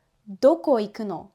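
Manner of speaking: fast, run together as one chunk. Shown as a demonstration. Teacher showing the natural flowing version. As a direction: let it flow as a single unit. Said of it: "hear as a chunk".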